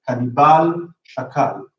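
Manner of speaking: "Kanibal" and "shakal" each stressed on the last syllable; final stress. Shown as a demonstration. Teacher showing consonant-final rule, "Kanibal, shakal" stressed at the end. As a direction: hit BAL in "Kanibal" and KAL in "shakal". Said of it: "you get final stress".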